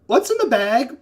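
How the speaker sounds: high, loud in-character question; calling out. Acted. Man outside relaying the question about the bag, loud high call.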